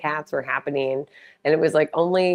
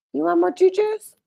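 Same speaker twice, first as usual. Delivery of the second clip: very high cooing pet voice; sweet high-pitched pet talk; 3 dB louder than usual. Real, not acted. Baby talk to her cat.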